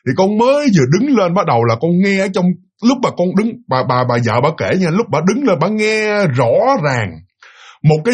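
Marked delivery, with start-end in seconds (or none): none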